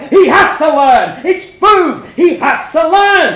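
very loud, high shouting; furious shout. A narrator's reading. Parents shouting at each other over the child. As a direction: blast it across the table.

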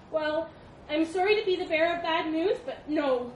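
high, loud monkey voice; apologetic monkey. A narrator's reading. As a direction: break the bad news in a high voice. Monkey refuses nervously.

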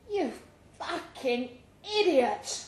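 injured cyclist groaning abuse from the road; groaning insult. Acted. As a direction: drag it out like you're hurt on the tarmac. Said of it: "the cyclist groaned".

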